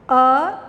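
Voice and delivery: loud, drawn-out letter sound; spelling out a sound. Shown as a demonstration. Teacher sounding out a letter for kids. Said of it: "यहाँ हम ये पढ़ेंगे".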